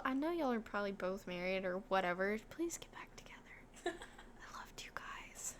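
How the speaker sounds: hushed, pleading whisper; whispered plea. Pretend. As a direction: plead under your breath, barely voiced. Hushed mock plea to characters.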